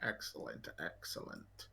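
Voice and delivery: put-on low, hushed, half-whispered old-friend voice; creepy old friend of the family. Old acquaintance savoring news of a great-grandmother, creepily hushed. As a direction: murmur it very softly and low.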